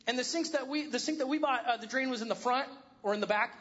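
ordinary delivery

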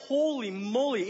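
ordinary talk, nothing marked

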